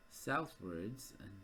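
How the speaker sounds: normal talking voice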